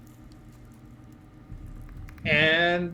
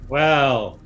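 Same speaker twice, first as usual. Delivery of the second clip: ordinary talk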